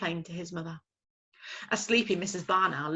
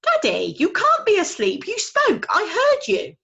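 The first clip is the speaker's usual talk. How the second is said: high, loud little owl insisting; insistent owlet; 14 semitones higher, 11 dB louder than usual. Acted. Owlet insisting his father spoke. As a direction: catch him out, small and eager.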